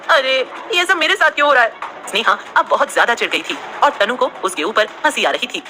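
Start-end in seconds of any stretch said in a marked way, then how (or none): 0.1-1.7 high exasperated complaint; irritated whine. A narrator's reading. Sneha whining after the monkey attack. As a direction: whine in frustration. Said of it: "चिढ़ते हुए".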